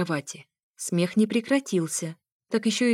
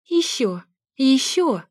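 high, urgent mock pleading; begging lover; 6 semitones higher, 5 dB louder, slower than usual. A narrator's reading. Narrator faking passion for an invisible lover, high begging cries. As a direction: beg for more in feigned passion. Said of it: "умоляла я своего невидимого любовника".

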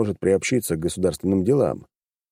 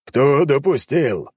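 growling father; 7 semitones higher, 4 dB louder, much slower than usual. A narrator's reading. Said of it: "зарычал он".

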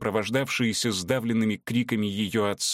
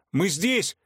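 much higher shout; calling out; 8 semitones higher than usual. A narrator's reading. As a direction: call out to be found. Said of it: "надсаживалась Анарина".

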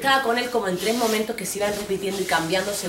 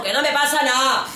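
high, louder yell; shouting back; 7 semitones higher, 6 dB louder than usual. Retold or imitated. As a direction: snap it back.

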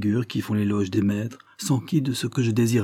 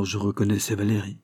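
usual speaking voice

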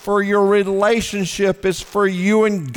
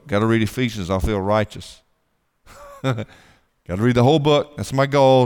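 lightly voiced smug Bible reader; mock the checklist reader, lightly; 5 semitones lower, 4 dB louder than usual. Preacher mocks people who read for the goal, lightly. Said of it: "Some people go".

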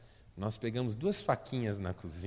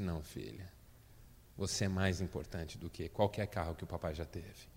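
tender father; 6 semitones lower, 7 dB quieter than usual. Retold or imitated. Father reassures grown daughter.

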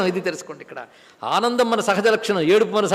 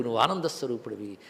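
soft echo; 9 semitones lower, 10 dB quieter than usual. Acted. Teacher repeats the assurance.

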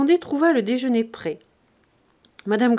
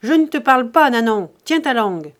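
angry scolding; 3 semitones higher, 9 dB louder than usual. Acted. Master silencing the servant who pleads for pity, angry scolding. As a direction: cut the servant off forcefully.

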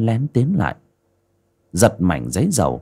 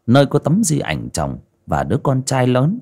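plain reading voice

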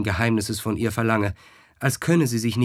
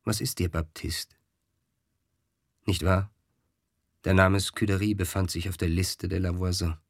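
mild smiling question; 3 semitones lower than usual. A narrator's reading. Scuderie gently teases her frightened servant Baptiste, mild and smiling. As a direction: tease him kindly, with a smile. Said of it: "fragte ihn das Fräulein mit mildem Lächeln".

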